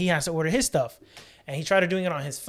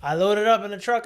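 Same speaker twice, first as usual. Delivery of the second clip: usual speaking voice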